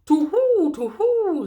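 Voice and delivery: high, loud hoots; owl hooting. Acted. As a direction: hoot like a startled owl.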